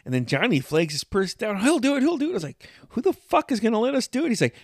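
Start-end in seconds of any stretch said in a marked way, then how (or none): 1.6-2.3 eager cousin. Retold or imitated. Johnny eager, retold. 2.9-4.3 incredulous. Retold or imitated. His own doubtful reply, retold.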